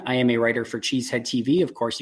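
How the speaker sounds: usual speaking voice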